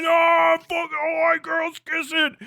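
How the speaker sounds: mock gasp. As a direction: play the scandalized conservative catching on. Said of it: "Immediately like".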